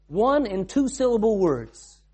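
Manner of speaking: "One and two syllable words" spaced out slowly, each word weighted; slow weighted repetition of "One and two syllable words"; slow emphatic repeat. Acted. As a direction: drive the phrase home slowly.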